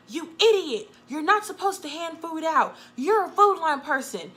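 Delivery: acted outburst from the boss on the phone; mock angry boss. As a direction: snap it out like a furious boss. Boss insults her on phone.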